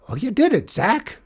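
high, triumphant cheer; excited cry. A narrator's reading. Janitor congratulating the rider, excited cry. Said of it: "he cried".

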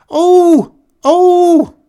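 huge, very high, very loud bellow; desperate bellow. A narrator's reading. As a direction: roar at the bear with everything she has. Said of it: "she bellowed desperately".

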